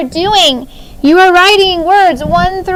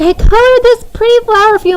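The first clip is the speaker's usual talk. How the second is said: daughter's voice; 7 semitones higher, faster than usual. Retold or imitated. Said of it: "she's like".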